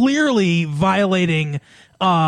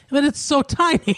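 put-on botched accent drifting into Italian; high mock-Italian foreign accent; Borat; 9 semitones higher than usual. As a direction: do a bad Italian-sounding comic foreigner. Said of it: "your Borat at that point was an Italian accent".

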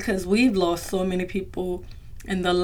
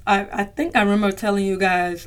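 normal talking voice

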